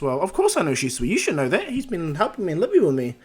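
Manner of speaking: loud, high-pitched protest; indignant insistence. Retold or imitated. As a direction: insist excitedly, voice climbing as you go. Naruto protests he knows Shisui.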